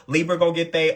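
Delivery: normal talking voice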